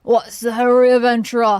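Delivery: gruff loud NPC voice. Shown as a demonstration. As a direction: bark it like a tough guard. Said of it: "you thought I'd give him a high voice 'cause he's a Lalafell".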